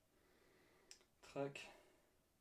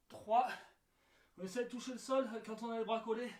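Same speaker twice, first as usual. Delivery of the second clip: counting reps mid push-up; doing push ups; 2 semitones higher than usual. Real, not acted. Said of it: "counting reps while doing push ups".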